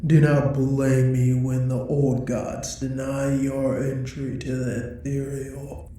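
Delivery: ordinary talk, nothing marked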